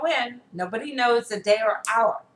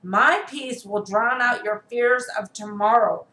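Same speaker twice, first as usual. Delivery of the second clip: ordinary talk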